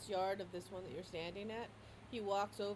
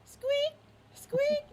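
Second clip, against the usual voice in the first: very high squeak imitation; high toy squeak sound; squeaky toy; 10 dB louder than usual. Shown as a demonstration. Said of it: "You hear the squeak".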